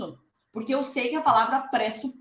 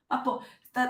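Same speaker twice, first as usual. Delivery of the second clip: ordinary delivery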